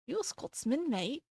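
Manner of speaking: soft, badly faked Scottish accent; attempted Scottish accent. Shown as a demonstration. Streamer asking a viewer if they're a Scot, attempted Scottish accent. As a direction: try a Scottish accent, quietly and clumsily. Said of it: "Not that I can do a Scottish accent".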